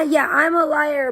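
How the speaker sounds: ordinary delivery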